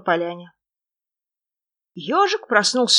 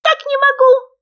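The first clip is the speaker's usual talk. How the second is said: very high, loud squeak; thin, high frog voice; thin little shout; 9 dB louder than usual. A narrator's reading. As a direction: pipe it loudly as a tiny creature. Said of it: "тоненько кричал кто-то".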